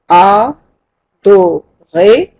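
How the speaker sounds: a-do-ré stretched out slowly, syllable by syllable; syllable count. Shown as a demonstration. Teacher counting syllables aloud for the class, slow. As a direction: count the beats of adoré slowly. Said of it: "voyons si j'ai douze syllabes".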